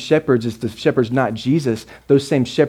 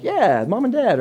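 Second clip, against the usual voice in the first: high eager mock parent voice; 9 semitones higher than usual. Mimicking parents in the crowd, eager and pitched high. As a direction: play the proud parents answering. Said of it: "The parents in here are like".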